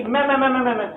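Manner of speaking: quick, repeated imitation bleats; a lion cub's failed roar coming out as bleats; goat-like bleating. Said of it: "بکری کی طرح ممیانے لگا".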